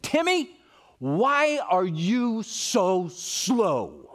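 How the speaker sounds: high, drawn-out exasperated yell; retold yell. Mother losing patience with slow Timmy, drawn-out yelled rebuke. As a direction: let the name fly up, then stretch the question out slowly. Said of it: "I just yelled at him and said".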